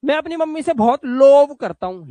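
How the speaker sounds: लोव with a long O, high and loud; mispronounced love. Acted. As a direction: act out the mistake, लोव as "lohv". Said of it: "लोव नहीं करते बेटा". (लोव को लंबे ओ से, ऊँची आवाज़ में)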